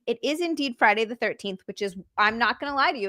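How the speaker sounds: usual speaking voice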